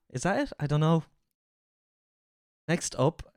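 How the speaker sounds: ordinary talk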